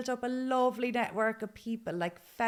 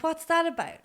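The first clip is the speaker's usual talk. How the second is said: ordinary talk, nothing marked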